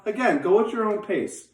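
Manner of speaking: usual speaking voice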